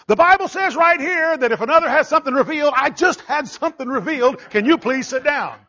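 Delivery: high, loud mock protest; retold indignant interruption. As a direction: imitate an insistent interrupter. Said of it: "And Steve says".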